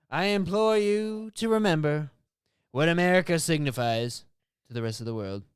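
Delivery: orate like a parody of a president; Donald Trump. Acted. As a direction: pitch up on the big phrases, then let them fall. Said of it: "I don't know why I'm talking like Trump".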